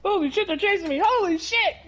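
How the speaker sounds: panicked high-pitched yelp; being chased. Acted. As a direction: cry out like you're being hunted down. Acting out a chased survivor.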